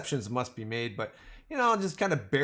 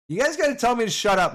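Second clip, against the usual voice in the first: excited; 8 semitones higher, 6 dB louder, faster than usual. Real, not acted. Begging chat, laughing.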